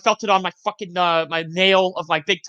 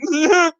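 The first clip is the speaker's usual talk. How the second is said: mock tearful whimper; 7 semitones higher, 4 dB louder than usual. Acts out hurt boy's tearful answer.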